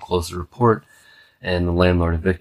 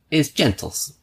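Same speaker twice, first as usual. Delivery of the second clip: high put-on mother's voice; imitating the mother; 8 semitones higher, slower than usual. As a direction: mimic the mom, pitched way up. Mother complains, voiced again.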